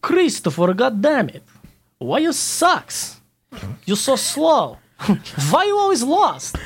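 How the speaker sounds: put-on broken English accent. Teammate scolding the slow runner in broken English, high and slow. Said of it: "in his broken English".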